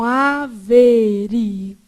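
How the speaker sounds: averigue said slowly, stress held on the i; wrong reading. Shown as a demonstration. Teacher showing students how people misread averigue. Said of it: "a gente lê errado".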